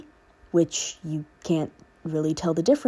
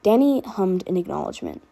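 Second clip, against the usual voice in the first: plain reading voice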